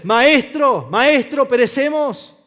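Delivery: high, loud panicked plea; desperate cry. Acted. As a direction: beg for rescue. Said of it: "acudiendo a él como su líder". (súplica aterrada a gritos)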